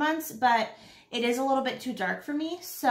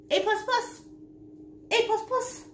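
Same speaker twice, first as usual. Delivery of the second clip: high sing-song; 11 semitones higher than usual. Pretend. Playful repeat of grade.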